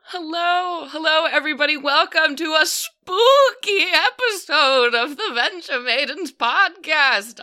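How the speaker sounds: high, quavering spookmaster voice; spooky high voice. Acted. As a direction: welcome them in a high ghostly warble. Spookmaster host voice.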